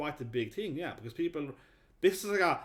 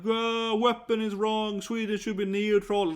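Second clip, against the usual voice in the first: retold screaming; 6 semitones higher, 4 dB louder, slower than usual. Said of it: "She started screaming at them".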